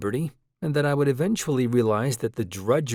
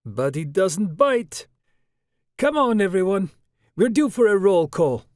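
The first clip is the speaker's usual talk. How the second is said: put-on louder, higher light Russian accent; 8 semitones higher, 6 dB louder than usual. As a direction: call the group in a Russian accent. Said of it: "in a light Russian accent".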